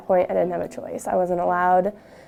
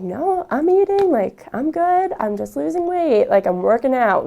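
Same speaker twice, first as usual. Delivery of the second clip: higher, louder, brushing it off; defensive denial; 5 semitones higher, 5 dB louder than usual. Retold or imitated. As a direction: insist you're fine, a little too bright. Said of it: "I'm like".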